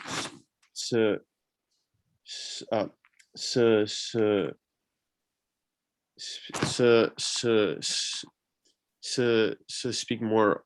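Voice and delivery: repeated s blocks, real stutter; stuck on the s. Real, not acted. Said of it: "a covert stutterer".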